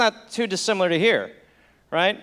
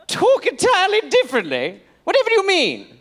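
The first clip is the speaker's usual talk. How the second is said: put-on high, baffled British accent; old British gentleman; 9 semitones higher than usual. Baffled colonist questioning his friend's idea, high British accent. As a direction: exclaim like a puzzled colonial Englishman.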